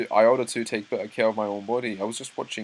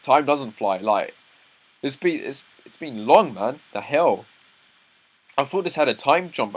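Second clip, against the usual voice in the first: exasperated; 4 semitones higher, 8 dB louder than usual. Real, not acted. Exasperated about the series' slow pace.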